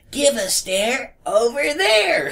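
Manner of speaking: high, slow tinny rhyming robot. Retold or imitated. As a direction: sing the rhyme slow and tinny, laughing. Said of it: "tinny, trying to sound electri-, like, robotic voice".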